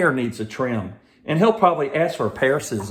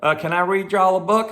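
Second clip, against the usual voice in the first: a cheery Southern Georgia greeting, raised up; Southern Georgia accent; 6 semitones higher than usual. Shown as a demonstration. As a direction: put on the Southern Georgia talk from back home. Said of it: "I used to talk like this".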